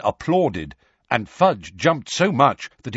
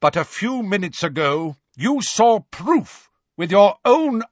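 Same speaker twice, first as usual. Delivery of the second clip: raised, forceful, deliberate rebuke; thunderous; 7 semitones higher, 4 dB louder than usual. A narrator's reading. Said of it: "in a thunderous voice".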